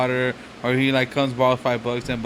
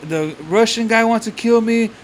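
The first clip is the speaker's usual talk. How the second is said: mock fearful plea; 7 semitones higher than usual. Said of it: "And he's like".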